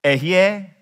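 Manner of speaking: Ehyeh said clearly as eh-hee-yeh; teaching the Hebrew Ehyeh; Hebrew word. Shown as a demonstration. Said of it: "In Hebrew".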